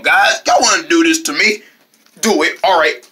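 ordinary talk, nothing marked

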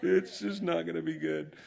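high, loud whiny plea; caffeine-starved churchgoer begging for coffee, high and loud; desperate coffee addict. Retold or imitated. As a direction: squeal like a coffee addict who doesn't care. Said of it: "they were just like".

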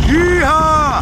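high whooping cowboy yell; yeehaw cheer. Real, not acted. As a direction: whoop it out with joy. Cheering at the boat.

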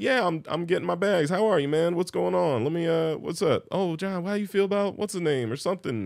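imitating dad. Son voicing his father greeting paparazzi at the airport, breezy and upbeat. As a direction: play dad chatting easily with photographers.

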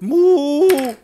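lautes, hohes Muhen eines Stiers; Kuhmuhen. Acted. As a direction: muh wie ein Stier im Schlachthof. Stier im Schlachthof nachgemacht. (lautes Stiermuhen)

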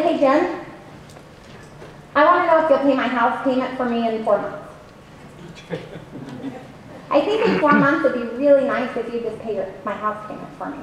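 low, soft, flat-voiced ask; unexcited ask. Shown as a demonstration. Coach acting a bored friend asking for a loan, unexcited. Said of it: "you don't really sound excited about it".

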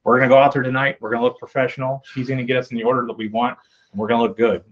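ordinary talk, nothing marked

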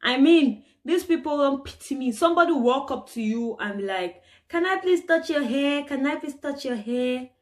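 ordinary talk, nothing marked

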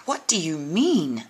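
put-on a child's upset yell at the wizards. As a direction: shout it out in alarm. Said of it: "Amanda yelled".

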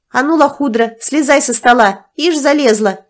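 commanding. A narrator's reading. Nastya ordering the woman off the table, commanding. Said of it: "скомандовала Настя".

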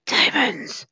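gasping, then a very high, far squeal; startled delight at the find; gasp then diamonds. Real, not acted. Player spots diamonds, gasps and squeals them out very high.

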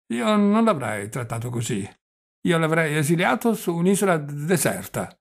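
put-on animated old man, pitch swooping up; dragged words and stammered consonants; doddering old prince. Old prince pitying Napoleon to his nephew, animated and halting.

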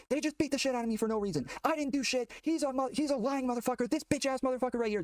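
high, furious yelled accusation; screaming and yelling. Retold or imitated. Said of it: "I start screaming and yelling".

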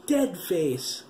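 put-on boy recoiling from the corpse's face; frightened cry. A narrator's reading. Tense frightened cry. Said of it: "Graham cried".

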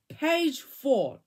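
plain reading voice

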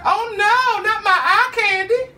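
high, loud, slow mock cry; mock despair. Mock crying about missing the eye candy. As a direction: fake wail over the lost spot. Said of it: "I was like".

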